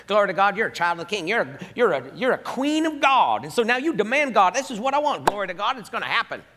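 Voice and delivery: high, loud Southern preacher impression; hammed-up Southern church exhortation; Southern accent. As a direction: crow like a demanding Southern preacher. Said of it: "go into a Southern accent when you do that".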